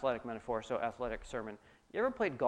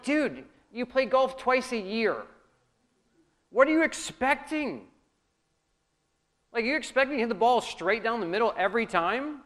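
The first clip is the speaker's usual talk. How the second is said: exasperated friend; 7 semitones higher, 6 dB louder than usual. Retold or imitated. Exasperated at bad golfer.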